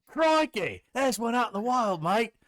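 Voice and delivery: put-on high, excited Australian accent; excited Australian croc hunter; Aussie wildlife host. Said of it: "known as the Chocodile Hunter".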